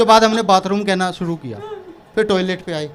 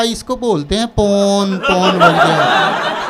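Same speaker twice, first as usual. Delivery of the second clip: mock slow memorising; much slower than usual. Girl memorising the word slowly.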